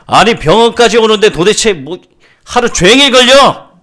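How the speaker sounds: high, full-volume furious father; angry shout. Acted. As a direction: yell at the doctor, drawing out the end. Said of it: "보자마자 소리를 지는 거예요". (화나서 버럭 지르는 고함)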